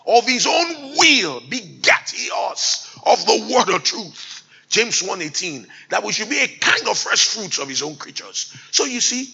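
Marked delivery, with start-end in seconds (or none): 0.1-4.4 slow, high, loud scripture cry; booming preacher reading; loud, slow proclamation. Real, not acted. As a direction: declaim the verse at full voice.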